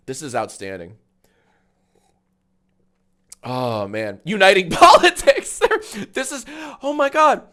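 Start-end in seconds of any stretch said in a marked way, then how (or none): 4.2-7.4 loud laughing burst, higher. Real, not acted. Streamer laughing loudly at uniting politics. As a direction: crack up loudly.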